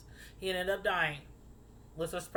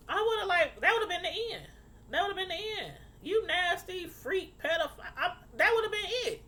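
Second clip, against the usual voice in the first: outraged; 9 semitones higher than usual. Retold or imitated. Her own outraged reaction.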